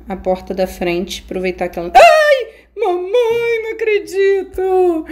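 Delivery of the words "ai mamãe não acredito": high shriek trailing into a sung wail; frightened scream. Real, not acted. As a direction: scream in shock at the trap. Caught by trap, screams. (grito agudo de susto)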